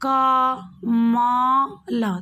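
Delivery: क, म, ल split apart, म loud; syllable by syllable. Shown as a demonstration. Teacher breaking कमल into letters for pupils. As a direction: break कमल into separate sounds. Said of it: "शब्द का उच्चारण, जैसे कमल".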